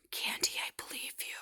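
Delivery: little sister's secret whisper. Retold or imitated. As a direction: breathe it like a secret in the car. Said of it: "a block away and goes".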